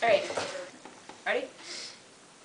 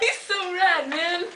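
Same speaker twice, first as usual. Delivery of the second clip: giggling words through tears; laughing near crying; 5 semitones higher than usual. Real, not acted. Still laughing.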